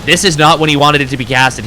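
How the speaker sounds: ordinary delivery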